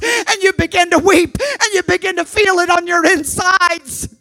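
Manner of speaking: rising revival preacher shout; preaching at full force. Acted. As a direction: thunder it from the pulpit.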